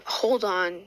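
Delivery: plain reading voice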